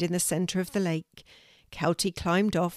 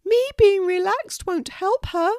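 high, loud, pressing little pelican voice; insistent pelican Moon; 13 semitones higher, 8 dB louder than usual. Acted. Moon insists to Kelty, high and loud. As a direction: push back hard, high and loud.

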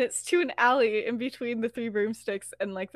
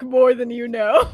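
loud, drawn-out retort breaking into a laugh; mock-ominous line through a laugh; 9 dB louder than usual. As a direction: say it slowly and loud, about to crack up. Terse retort, teller starts laughing.

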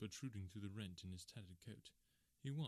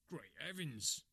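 a startled exclamation, a little louder; cried out; 3 semitones higher, 5 dB louder, slower than usual. A narrator's reading. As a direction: burst out in shock at the unmasking. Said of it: "cried the inspector".

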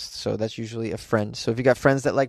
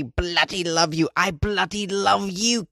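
high-pitched mock British gushing; put-on UK accent; 6 semitones higher, slower than usual. Host echoing UK listener Kirsty's card, hammed-up British accent. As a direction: lay on a bad British accent, rising higher. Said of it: "in that accent because it's from the U K".

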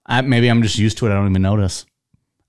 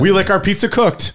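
higher-pitched Midwestern parody; mock Midwestern accent; 10 semitones higher than usual. Joking about how we Midwesterners talk, mock Midwestern accent. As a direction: ham up the Midwestern vowels.

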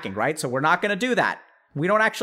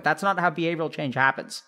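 usual speaking voice

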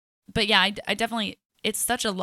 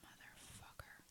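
whispering; 32 dB quieter, slower than usual. Real, not acted. Whispered curse about customer.

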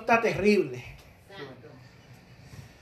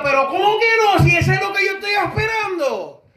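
high-pitched, shouted acted protest; raised, insistent retort in a retold scene; indignant protest; 7 semitones higher than usual. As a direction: play the blind man refusing to be silenced. Acted protest against being silenced.

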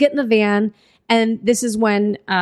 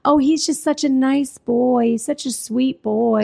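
higher, cooing imitation of doting women; mock-syrupy fondness; super-sweet women; 4 semitones higher than usual. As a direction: gush sweetly in a put-on voice. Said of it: "just being super sweet about stuff".

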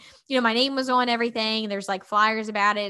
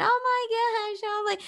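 mortified gasp; 11 semitones higher than usual. Retold or imitated. Mortified, parents watching.

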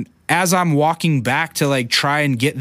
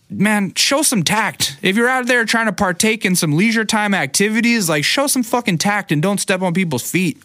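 retold rant; 8 semitones higher than usual. Ranting at the intruding couple.

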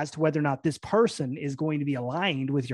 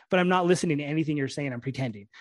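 ordinary delivery

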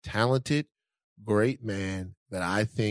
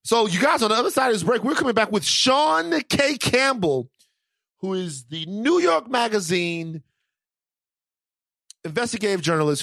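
hyped announcement; 10 semitones higher, 4 dB louder than usual. Real, not acted. Announcing upcoming guest.

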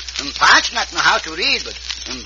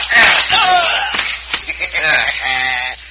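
hearty laugh; 2 semitones lower than usual. Acted. Pancho laughs after the fight.

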